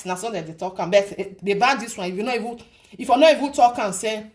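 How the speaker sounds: ordinary delivery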